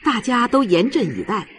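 usual speaking voice

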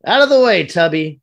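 loud, high, pushy bark; rude shout. Acted. Agnes to Comic Book Guy, rude shout. Acted rude barging past someone.

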